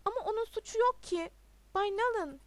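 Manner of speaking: high, loud objection; defiant protest. A narrator's reading. Pupil defends his teacher to the headmaster, defiant protest. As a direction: protest loudly, refusing to be silenced. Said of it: "dedi Todd susmayı reddederek".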